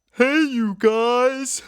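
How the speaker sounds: high, slow, stretched cartoon shout; big loud high-pitched catchphrase yell; impression. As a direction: belt it out like a goofy kid hero. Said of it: "could do us a little impression".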